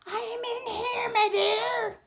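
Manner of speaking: wolf faking grandmother, a bit off; the wolf's fake grandma voice; funny voice. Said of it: "a voice that sounded a little funny".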